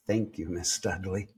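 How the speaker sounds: soft, low relieved thank-you under the breath; quiet thanks. Retold or imitated. Owner quietly thanking the ghost after the receptionist quits, soft. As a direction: murmur the thanks as if to yourself.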